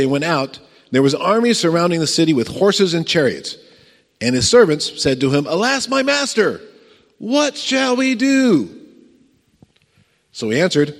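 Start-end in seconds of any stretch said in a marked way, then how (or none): 5.5-8.7 high, drawn-out wail of alarm; hammed-up dismay. Panicked servant crying out to his master, hammed-up dismay. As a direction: wail it slowly in mock despair.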